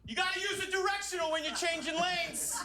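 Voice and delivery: high, prim teacher's scolding; substitute teacher lecture. Retold or imitated. Driver lecturing another driver at a red light, prim. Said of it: "Like a real man. real substitute teacher man".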